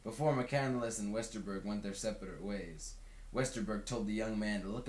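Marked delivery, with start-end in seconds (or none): none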